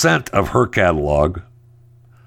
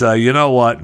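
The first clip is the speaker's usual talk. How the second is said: ordinary delivery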